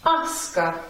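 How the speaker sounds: "Aska" with stress on the opening A; teacher modelling the stressed A in "Aska"; upstress on first syllable. Shown as a demonstration. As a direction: stress the A of "Aska", let "ska" drop. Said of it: "right above the sound on".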